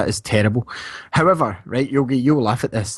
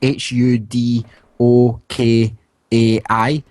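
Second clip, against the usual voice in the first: spelling slowly; 3 dB louder, much slower than usual. Shown as a demonstration. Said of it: "It's called Dark Matter Hudokai".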